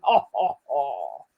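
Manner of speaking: low, mock villain "ho, ho, ho"; evil laugh. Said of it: "my scary voice".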